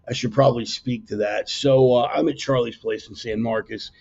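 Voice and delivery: usual speaking voice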